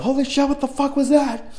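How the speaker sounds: high-pitched freaked-out exclamation; retold panic. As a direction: re-enact the fright in a high, strained pitch. Retold panic after fleeing haunted house.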